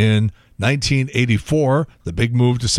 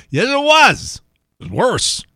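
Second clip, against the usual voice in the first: emphatic rebuttal; 10 semitones higher than usual. Real, not acted. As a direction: push back hard, pitch leaping up. Rebutting people who deny it.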